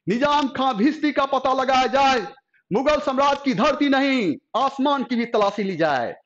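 loud, high proclamation; commanding order. A narrator's reading. Emperor ordering his army to search, loud command.

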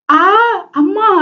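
sudden high yelp of pain; cry out. A narrator's reading. Bitten on the cheek, she yelps in sudden pain. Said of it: "என கத்திக்கொண்டு".

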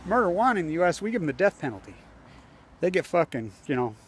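ordinary delivery

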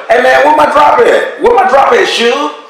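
mock tough demand for what he's owed; acting bold on the phone, pressing hard. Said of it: "I didn't say it like that".

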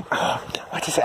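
soft whispered question. Real, not acted. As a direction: hush it to the dog. Quiet aside to dog.

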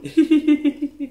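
high, bubbly giggle; teasing giggle. Acted. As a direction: giggle at your own jab. Teasing the other character.

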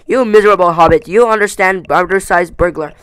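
loud outburst at the burglar; shouted insult. A narrator's reading. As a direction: shout it, at a loss for words. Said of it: "he shouted at a loss for words".

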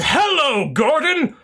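a half-hearted try at the scientist's hello, high; attempted scientist voice. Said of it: "I can't quite do the voice".

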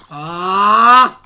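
loud sudden yelp when struck; startled yelp. Real, not acted. Player hit by the goat, loud startled yelp. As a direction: cry out as if knocked back.

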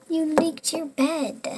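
put-on slow it down and scold her child; scolding mom. Mom scolds daughter over bed.

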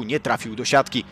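ordinary talk, nothing marked

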